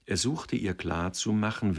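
ordinary delivery

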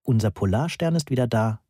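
usual speaking voice

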